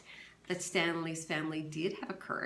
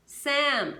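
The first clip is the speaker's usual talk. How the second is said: calling out; 8 semitones higher, 9 dB louder than usual. Acted. Someone called out to Sam.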